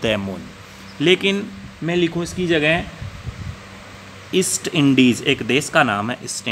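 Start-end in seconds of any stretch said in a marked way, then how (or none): 0.0-0.4 "द moon" with a short schwa "thuh"; the before consonant. Shown as a demonstration. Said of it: "इसका उच्चारण क्या हो जाएगा".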